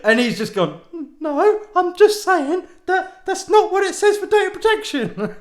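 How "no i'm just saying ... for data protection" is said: much higher, louder squeaky mock voice; laughing high-pitched mimicry; mocking offended operator. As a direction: send him up as a prissy jobsworth. Mocking offended call centre man.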